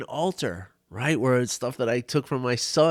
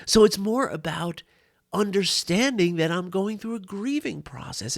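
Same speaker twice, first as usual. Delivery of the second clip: normal talking voice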